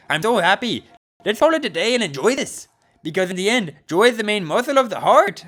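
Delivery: high, loud, joyful coach; overjoyed coach. Acted. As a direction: proclaim joy to the whole class.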